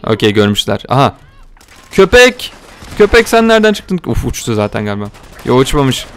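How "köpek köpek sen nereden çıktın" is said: high, loud surprised cry; shocked high call; startled yelp. Real, not acted. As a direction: jump at the sudden dog. Startled by a dog.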